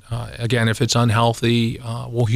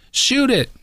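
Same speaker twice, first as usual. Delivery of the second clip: much higher mock order to shoot; imitated command; 13 semitones higher than usual. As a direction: bark it high like the impatient dad. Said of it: "this guy's telling him".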